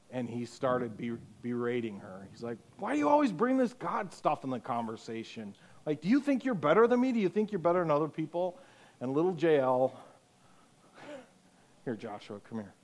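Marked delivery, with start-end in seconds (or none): 2.8-8.6 put-on high, fast sneering teen boy; high fast taunting bully; mocking jerk voice. Jerk quarterback voiced in story.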